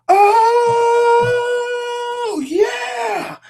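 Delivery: high excited whoop; excited cheer. Retold or imitated. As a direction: celebrate a trick shot. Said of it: "and they're like".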